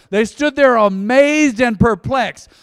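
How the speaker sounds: scripture reading. Acted. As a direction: announce the verse to the church. Reading the next verse.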